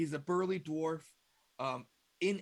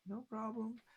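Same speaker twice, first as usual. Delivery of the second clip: obliging secretary; 7 semitones higher, 3 dB quieter, slower than usual. Acted. Secretary replies to boss.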